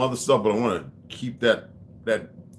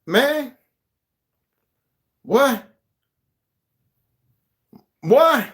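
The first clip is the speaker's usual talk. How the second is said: high, loud, incredulous yelling; hammed-up high-pitched outburst; outraged movie character; 13 semitones higher, 7 dB louder than usual. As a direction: squawk it in disbelief, imitating the character. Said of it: "the other brother character who said".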